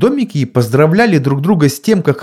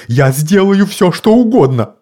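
frightened mumble; 8 semitones higher, 4 dB louder than usual. A narrator's reading. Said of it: "забормотал мистер Уилкс".

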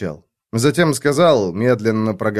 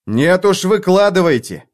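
angry cry, higher and insistent; shouted out; 6 semitones higher, 3 dB louder than usual. A narrator's reading. Erk demanding the truth from Alex, shouting. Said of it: "выкрикнул я".

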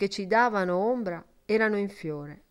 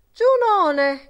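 high, loud cry of despair; sudden wail of ruin; anguished cry; 11 semitones higher, 7 dB louder than usual. A narrator's reading. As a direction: cry out to the goddess in dismay. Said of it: "gridò".

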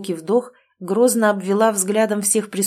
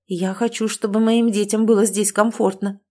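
ordinary delivery

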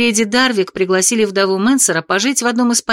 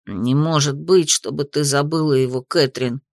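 put-on a lightly stern young boy; 7 semitones lower than usual. A narrator's reading. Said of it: "строго произнёс Ники".

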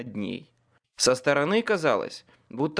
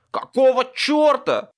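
loud, high angry bark; startled, indignant shout; 11 semitones higher, 9 dB louder than usual. A narrator's reading. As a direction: bark it in outrage. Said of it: "рявкнул Руди".

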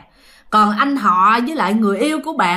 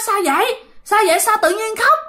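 high, alarmed questions; startled asking; 10 semitones higher than usual. Retold or imitated. Older sister startled as the younger one bursts into tears, high alarmed. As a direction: ask in sudden alarm what is wrong. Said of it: "bạn mới hết hồn".

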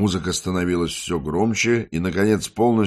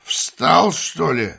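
окрик; 10 semitones higher, 4 dB louder, much slower than usual. Acted. Валерка кричит через дверь.

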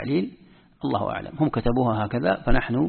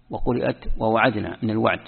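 ordinary delivery

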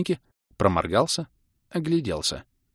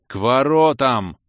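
nearly pleading; 4 semitones higher, 6 dB louder, slower than usual. Acted. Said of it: "чуть ли не взмолился я".

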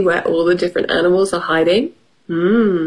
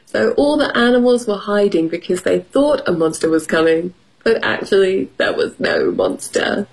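ordinary delivery, nothing marked

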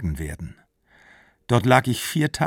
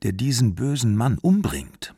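ordinary delivery

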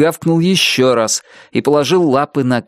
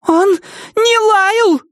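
much higher, thin, like helium; slower than usual. Acted. Nettie defending her dog, high thin squeak. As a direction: squeak it like you've inhaled helium. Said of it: "Голос Нетти стал высоким и тонким".

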